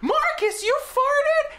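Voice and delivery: high-pitched, loud indignant shriek; mock shocked mother yell. Son mimics his mother catching him out, shrieking high. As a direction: imitate a scandalized mom crying out.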